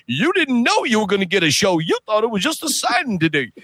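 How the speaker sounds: playful showman, just a hint; Carl Weathers voice. Retold or imitated. Retelling Carl's words.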